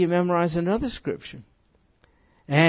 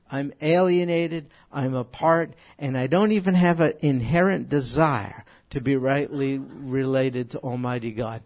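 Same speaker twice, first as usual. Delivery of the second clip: ordinary delivery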